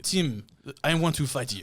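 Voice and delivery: lightly voiced accented game fighter; Street Fighter character. Extending the video-game joke, playful Street Fighter voice. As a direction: challenge him as a mock game character. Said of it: "like a Street Fighter".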